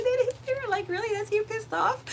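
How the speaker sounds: high, laughter-choked retelling; squealing with amusement. Real, not acted. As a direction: squeak it out while trying not to laugh. Laughing at others' disbelief.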